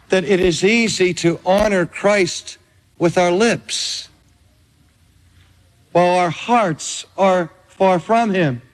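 ordinary delivery

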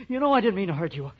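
fast, loud, frantic excuse; panicked pleading. Acted. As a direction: plead your innocence in a rising panic. Pleading with the unconscious woman.

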